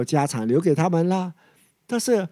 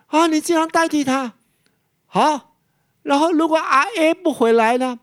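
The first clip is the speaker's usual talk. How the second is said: king's voice, pitched much higher in disbelief; a king who can't believe what he's hearing, voice shooting up; amazed king; 10 semitones higher than usual. Acted. (国王难以置信，声音高高扬起)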